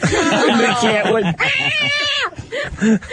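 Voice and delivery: shrieking, very high cat yowl; cat screech. Retold or imitated. Telling of the burning cat, a long high yowling screech.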